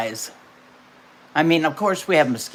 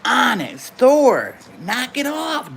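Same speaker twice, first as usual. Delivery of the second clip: scolding; 7 semitones higher, slower than usual. Real, not acted. Scolding the baby suddenly.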